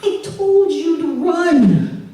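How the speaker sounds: slow, high, drawn-out scolding; dad scolding. Retold or imitated. Dad scolding his wife Michelle, slow and drawn out. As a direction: spell it out slowly like he's the reasonable one. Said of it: "he says".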